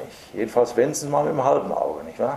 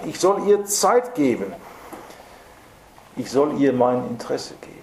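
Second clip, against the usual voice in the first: ordinary talk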